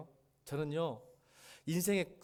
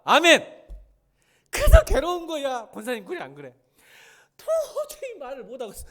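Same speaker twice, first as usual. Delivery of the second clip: high, loud anguished sermon cry; full-force preaching; 7 semitones higher, 11 dB louder than usual. Acted. Preacher torn because he cannot deny God, full-force preaching. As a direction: cry out the torment to the congregation.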